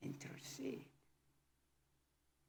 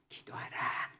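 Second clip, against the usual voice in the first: ordinary talk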